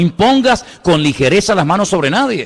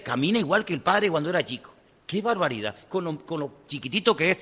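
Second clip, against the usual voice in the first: hushed doting remark; 12 dB quieter than usual. Retold or imitated. Mimicking doting relatives' remark.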